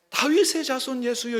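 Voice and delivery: calling out. Shown as a demonstration. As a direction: cry out 다윗의 자손 예수여 up high. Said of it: "이렇게 불렀어요".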